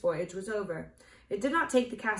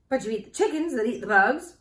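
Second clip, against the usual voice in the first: higher, louder hen voice; odd, clucky retort; cackling hen retort; 4 semitones higher, 7 dB louder than usual. A narrator's reading. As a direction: throw it back like a cackling hen. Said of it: "retorted the yellow hen with an odd cackle".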